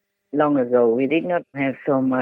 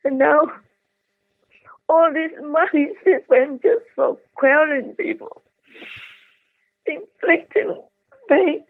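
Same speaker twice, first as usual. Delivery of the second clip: pitched higher, grieving as the tears come; tearful grief; 8 semitones higher than usual. Real, not acted. Grieving aloud over suffering in Ukraine, tearful and higher. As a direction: voice lifting with real sorrow. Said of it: "But I just cry again".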